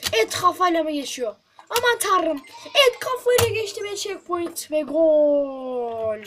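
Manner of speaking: loud, slow goal-call cheer; excited commentary. Real, not acted.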